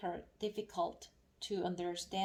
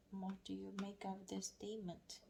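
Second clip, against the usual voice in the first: softer whispered reread of the question; 9 dB quieter than usual. Shown as a demonstration. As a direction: whisper it to yourself while reading.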